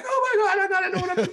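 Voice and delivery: high, loud laughing panic; inner panic. Retold or imitated. Comedian revealing his hidden stage fright, high panicked laugh. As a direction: squeal it like you're secretly terrified. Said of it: "But I'm really like".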